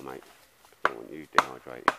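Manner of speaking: ordinary talk, nothing marked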